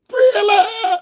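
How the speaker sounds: hammed-up horror shriek. Horror theme punchline.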